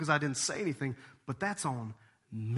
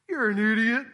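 mock scolding; 7 semitones higher, 7 dB louder than usual. Mocking the man who ignored warning.

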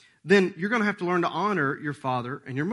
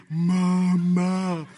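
put-on lightly sing-song mama, mama to a baby; a hint of goofy parent baby talk; 4 dB louder, much slower than usual. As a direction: coax the baby to say it, hammed-up. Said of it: "You make all these goofy".

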